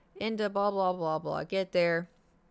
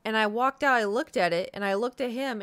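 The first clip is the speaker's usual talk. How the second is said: ordinary delivery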